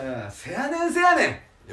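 soft, playful Kansai せやねん; Kansai dialect. Acted. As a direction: shrug it off in a hammy Kansai voice. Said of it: "変なとこで関西弁出すな".

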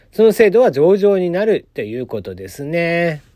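usual speaking voice

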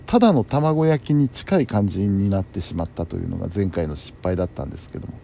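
normal talking voice

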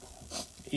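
a sudden real sneeze. Real, not acted. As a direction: sneeze mid-sentence. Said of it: "ちょっとくしゃみが出ちゃいましたね".

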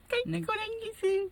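high plaintive wife voice; troubled housewife voice. Retold or imitated. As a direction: lament it like a deserted wife. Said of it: "みたいな". (悲しげな奥さんの声)

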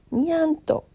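high, cutesy meowing "にゃんと"; cat voice. Pretend. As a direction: say it like a playful cat. Cat-like pun on なんと. (猫なで声)

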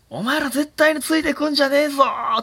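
comic shouted animal voice; bossy elephant leader. Pretend. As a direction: play the herd leader barking at the others to stay back.

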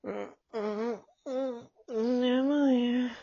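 slow, drowsy half-asleep murmur; sleepy mumble. Acted. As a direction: drag the words out, still asleep. Sleepy boy waking up. (寝ぼけた声でゆっくりと)

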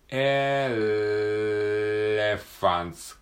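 "エ レファンツ" with a very long held L before the rest; exaggerated long L. Shown as a demonstration. Teacher exaggerates the L in "エ レファンツ" for drilling. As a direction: hold the L almost too long. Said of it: "大げさなぐらい長く".

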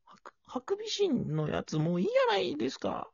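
whispered high complaint. Acted. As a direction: breathe the line as a whisper. Acting out character line. (ささやき声)

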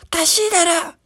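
put-on a drunk starting a fight; imitated drunken yelling. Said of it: "なんか声でかいな". (酔っ払いの怒鳴り声の真似)

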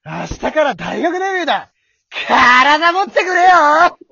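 loud, with a high, slow drawn plea at the end; straining hero. Shown as a demonstration. Second try at the bit, straining hero cry. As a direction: push to your limit on the last words. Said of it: "もう一回言ってみ".

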